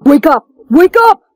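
rising urgent yells; shouting wake up. Acted. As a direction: yell to wake them. Waking a friend.

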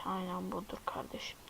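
pleased hushed whisper; satisfied unvoiced whisper. Real, not acted. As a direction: breathe it out as if it's a secret. Found the right item.

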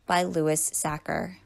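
usual speaking voice

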